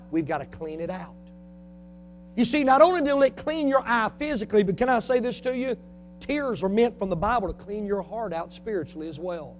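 normal talking voice

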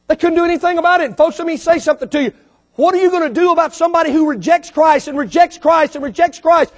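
ordinary talk, nothing marked

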